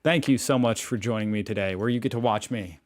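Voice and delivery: normal talking voice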